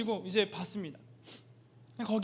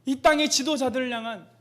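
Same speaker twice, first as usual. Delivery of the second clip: much louder, higher, pained sermon cry; full-force preaching; 5 semitones higher, 8 dB louder than usual. Acted. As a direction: thunder God's grief over the leaders. God's lament preached at full force. (하나님의 아픔을 외치는 설교)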